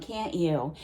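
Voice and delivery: ordinary talk, nothing marked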